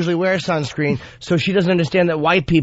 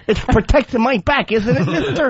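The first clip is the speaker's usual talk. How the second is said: mock child voice; 8 semitones higher than usual. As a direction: ham up a little kid. Acting as a child.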